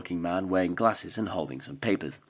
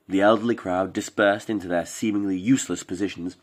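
plain reading voice

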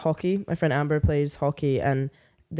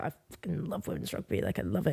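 hushed imitated enthusiasm; 9 dB quieter than usual. Quoting the drunk guy praising women's rugby, hushed. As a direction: confide it quietly, as if quoting him.

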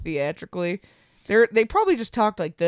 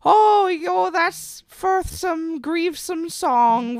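high, slow, unbothered peasant voice; unimpressed peasant; 10 semitones higher, much slower than usual. Pretend. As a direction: shrug it off like a hardened peasant. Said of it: "they're gonna be like".

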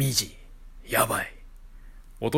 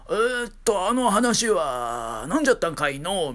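put-on old man voice; 6 semitones higher than usual. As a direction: play a rambling grandpa. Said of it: "みたいな". (おじいさん口調)

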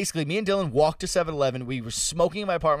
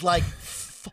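usual speaking voice

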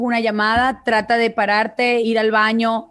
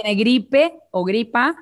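ordinary talk

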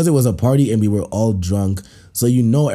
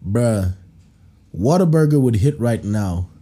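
ordinary talk, nothing marked

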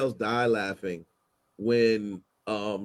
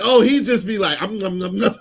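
louder mock Starlight moaning hum; hammed-up quote; 5 semitones higher, 7 dB louder than usual. Retelling Starlight's line to cohosts, hammed-up mock voice. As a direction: retell it bigger with teasing hums. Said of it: "She was like".

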